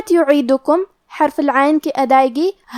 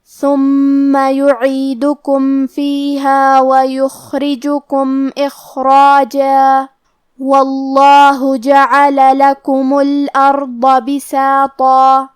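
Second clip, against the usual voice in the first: slow, melodic tajweed chanting; Quran recitation; 4 dB louder, much slower than usual. Shown as a demonstration. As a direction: draw out each vowel in recitation.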